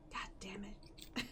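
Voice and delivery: muttered under the breath; whispering. Real, not acted. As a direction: mutter it barely voiced. Cursing under breath after yawn.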